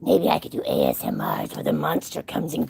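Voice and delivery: playful low monster voice, half laughing; mock monster voice. Jokes about monster ASMR videos.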